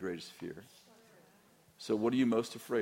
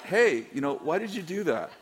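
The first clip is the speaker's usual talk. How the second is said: forced friendly laugh; 8 semitones higher, 7 dB louder than usual. Retold or imitated. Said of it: "When I'm like".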